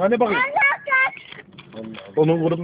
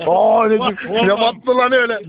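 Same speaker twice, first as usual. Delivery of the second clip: loud, higher, startled shout; şaşkın bağırma; 4 semitones higher, 6 dB louder than usual. Real, not acted. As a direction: cry out in shock. Shocked by what pricked him. (yüksek sesle şaşkın bağırış)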